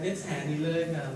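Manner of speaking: usual speaking voice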